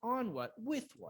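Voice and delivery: high pitch on ON and WITH; brisk list stressing On, With; stress on prepositions. Shown as a demonstration. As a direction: punch On and With, drop on what. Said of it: "need to find another preposition".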